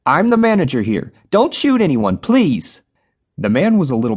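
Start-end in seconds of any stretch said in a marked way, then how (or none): 0.0-2.8 frightened plea. A narrator's reading. Frightened manager pleading.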